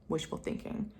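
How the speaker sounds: soft unvoiced aside; wry whisper. Real, not acted. Commenting on her hopes for counseling, wry whisper. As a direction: say it dryly under your breath.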